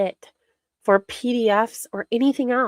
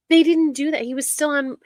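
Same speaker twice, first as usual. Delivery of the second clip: usual speaking voice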